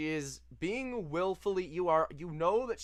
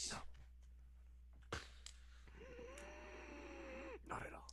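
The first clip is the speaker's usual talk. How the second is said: whisper; 21 dB quieter, much faster than usual. Acted.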